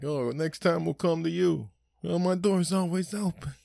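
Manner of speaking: high, far-off sing-song farewell; mimicked departing guests. Guests promising to host next time, high gushing voice. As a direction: gush goodbyes at the door.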